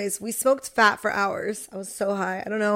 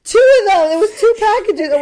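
excited; 10 semitones higher, 8 dB louder than usual. Real, not acted. Friends recalling the dog story.

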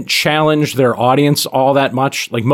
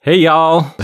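put-on southern accent; 6 semitones higher, 4 dB louder than usual. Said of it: "we're attempting to, uh, fit into the theme".

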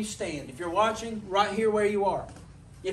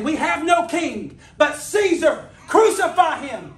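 loud, high, slow crowd shout of the mob; mob cry; 7 semitones higher, 8 dB louder, slower than usual. Retold or imitated. Preacher voicing the crowd demanding crucifixion, loud slow shouting.

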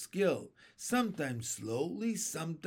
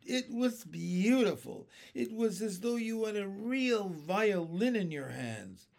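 put-on higher, wonder-struck boy's voice; an enraptured young listener; enchanted; 6 semitones higher than usual. A narrator's reading. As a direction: gush with admiration, pitched up. Boy enthuses over the music.

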